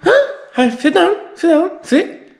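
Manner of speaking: high-pitched dog talk; high, playful commands to a pet. Real, not acted. Owner telling his dog to sit, high dog-talk voice.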